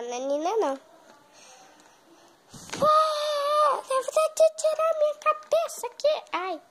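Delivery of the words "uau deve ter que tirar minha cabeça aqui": high, loud, squealing wow; excited shout. Acted. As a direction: burst out in high astonishment. Reacting to ugly school. (grito agudo e espantado)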